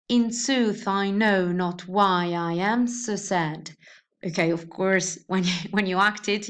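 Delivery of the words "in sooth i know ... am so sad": slow, measured beat on "SOOTH, KNOW, WHY, AM, SAD"; "In SOOTH, i KNOW not WHY i AM so SAD", scanned rather than acted; exaggerated iambic reading. Shown as a demonstration. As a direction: read it metrically, stressing every second syllable of "In sooth, I know not why I am so sad". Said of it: "you don't read it like that".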